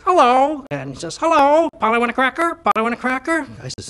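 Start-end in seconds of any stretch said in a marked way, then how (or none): 0.0-0.6 high, loud parrot squawk of a greeting; talking parrot. Acted. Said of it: "the parrot says". 1.2-3.5 high-pitched pet-parrot voice; talking parrot. Acted. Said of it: "the parrot says".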